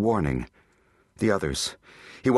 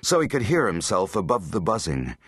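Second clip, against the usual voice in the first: ordinary delivery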